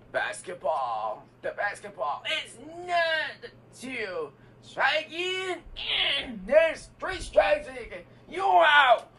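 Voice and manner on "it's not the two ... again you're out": high, loud you're out; mock umpire shout. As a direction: yell it like an umpire. Host calls out strikes.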